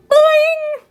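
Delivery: high, loud springy yell; yelled boing. Acted. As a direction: shout it as the curl springs.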